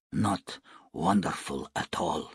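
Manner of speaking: low, quiet, sober correction; soft-spoken. A narrator's reading. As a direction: say it softly and gravely. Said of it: "said Nu softly".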